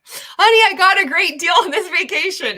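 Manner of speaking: hammed-up high, loud announcement of a bargain; excited mock quote. Partner telling honey about a vacation deal, mock excited.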